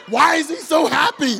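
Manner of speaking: incredulous. Pretend. Puzzled onlookers.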